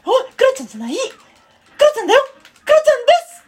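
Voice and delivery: squeaky falsetto catchphrase; very high, childlike put-on voice; Kuro-chan. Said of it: "常にクロちゃんで行く". (甲高い裏声のモノマネ)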